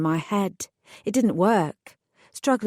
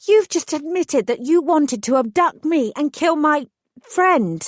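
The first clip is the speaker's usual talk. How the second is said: outraged; 8 semitones higher, 5 dB louder than usual. Acted. Outraged reply to thug.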